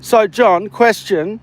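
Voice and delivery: usual speaking voice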